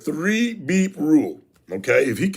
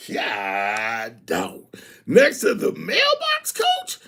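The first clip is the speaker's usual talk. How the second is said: mock outrage; 2 semitones higher, much slower than usual. Real, not acted. Host reacts to a strict coach's rule, mock disbelief. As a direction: act shocked at the rule.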